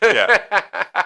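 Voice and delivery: laughing yeah, high and loud. Real, not acted. Host cracking up at the mooning joke, laughing. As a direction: burst out laughing on it.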